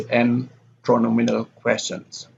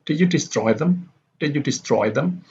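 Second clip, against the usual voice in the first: yes/no question climbing from "destroy" through "them"; rising intonation; 4 semitones higher than usual. Shown as a demonstration. Teacher models a yes/no question, rising on "destroy". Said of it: "destroy here goes up".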